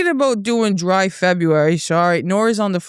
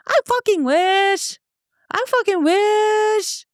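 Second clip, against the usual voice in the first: emphatic wish; 12 semitones higher, much slower than usual. Real, not acted. Rebutting claim she fakes accent.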